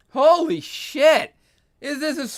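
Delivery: high, slow, drawn-out disbelief; shocked exclamation. Real, not acted. Reviewer reacting to an awful comic panel, stunned. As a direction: let it stretch out in shock.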